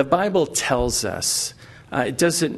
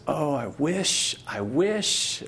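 put-on wistful kid; 3 semitones higher, much slower than usual. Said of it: "And the kid goes".

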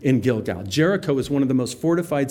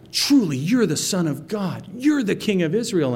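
awed confession; 7 semitones higher than usual. Retold or imitated. Nathanael amazed, confessing Jesus.

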